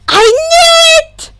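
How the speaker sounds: high, loud screech; excited inventor screeching at him, high and loud. Acted. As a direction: screech it the moment he walks in. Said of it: "practically screeched".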